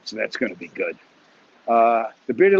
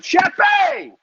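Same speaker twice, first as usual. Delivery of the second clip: big high shout of a name; excited shout; 6 dB louder than usual. Real, not acted. Spotting Sheppy in the chat, high excited shout. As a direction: call out a friend's name with joy.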